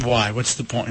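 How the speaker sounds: defeated sigh. Real, not acted. Despair after the loss.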